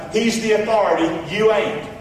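usual speaking voice

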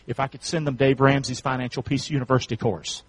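usual speaking voice

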